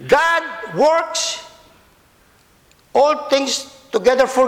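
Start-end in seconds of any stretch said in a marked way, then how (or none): 0.1-1.5 "God works" set apart, high and weighty; stress on works. Shown as a demonstration. Preacher showing Paul's emphasis to the congregation, stress on "works". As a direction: hit "works" firmly after a high "God". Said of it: "Paul is emphasizing it in that word".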